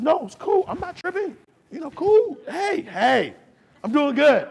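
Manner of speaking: high-pitched comic brush-off; mock casual reassurance. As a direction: play it cool in a lifted voice. Acted man brushing off tearful woman.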